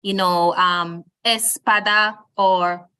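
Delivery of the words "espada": espada misstressed on the opening ES; wrongly stressed first syllable. Shown as a demonstration. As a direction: hit ES in espada, not PA. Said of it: "and not something like".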